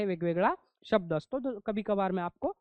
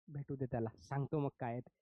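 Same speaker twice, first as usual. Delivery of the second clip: much softer, mumbled under the breath; mumbling; 9 semitones lower, 10 dB quieter than usual. Shown as a demonstration. Teacher shows mumbling to the class, muttered softly. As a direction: swallow the words so nobody hears them. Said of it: "आपण असं".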